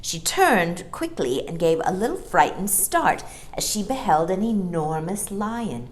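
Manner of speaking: ordinary delivery, nothing marked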